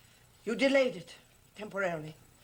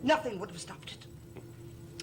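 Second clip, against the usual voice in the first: ominous hush; 8 dB quieter than usual. Acted. Servant warns his master of fate, hushed and ominous. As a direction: breathe it out like a dark warning.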